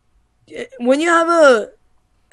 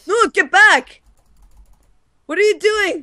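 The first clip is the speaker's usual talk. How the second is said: panicking gamer; 8 semitones higher than usual. Real, not acted. Fleeing enemies in panic.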